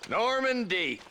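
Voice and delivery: slow, high, loud call of the name. Acted. Marshal calling out to the captured outlaw, loud. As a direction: hail him from afar.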